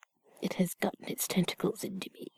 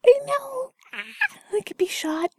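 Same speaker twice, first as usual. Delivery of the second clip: mock dramatic cry; 5 dB louder than usual. Mock horror at own confession.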